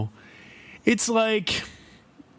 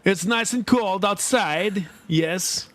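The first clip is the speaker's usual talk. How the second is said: put-on higher Polish accent, hammy; creepy Polish stalker voice; 3 semitones higher than usual. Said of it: "I'd be like".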